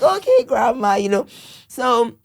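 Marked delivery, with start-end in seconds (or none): 0.0-1.0 higher, slowish breezy brush-off; imitating her sons. Mother mimics her boys shrugging off grandma, higher and breezy. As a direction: toss it off like a kid ignoring grandma. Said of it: "They're just like".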